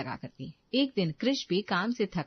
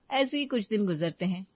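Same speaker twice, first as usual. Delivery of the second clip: ordinary delivery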